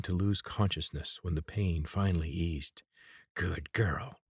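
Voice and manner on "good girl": cold hissed whisper. A narrator's reading. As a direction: breathe it out, chilling whisper. Old man after torturing her.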